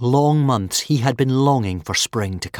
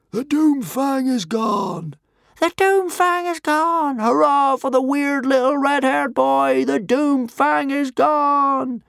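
high, loud, slow triumphant cheering; crowd shouting; 6 dB louder, slower than usual. A narrator's reading. Watching hysterics cheer from the cliff tops, shouting. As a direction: roar it out like a jubilant crowd. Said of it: "began to shout".

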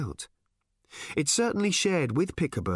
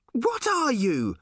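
high, drawn-out shout; shouted question; 12 semitones higher, 3 dB louder, slower than usual. A narrator's reading. Random confronting the multiplying bird, shouted. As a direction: cry it out high and slow. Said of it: "shouted Random".

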